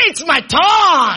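drawn-out rallying cry, TURN held long; emphatic shout. Shown as a demonstration. Preacher rousing a half-hearted congregation, emphatic shout. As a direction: shout it out slowly to rouse them. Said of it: "some of you are not serious".

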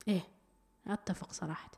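soft unvoiced aside; a quick whispered agreement. Real, not acted. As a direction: whisper it under your breath. Aside agreeing during listener messages.

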